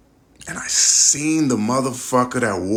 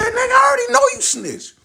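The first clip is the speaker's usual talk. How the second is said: a much higher, shouted accusation; retold shout; 3 dB louder than usual. Confronting the man who wore the wire, shouting. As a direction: yell it straight at him.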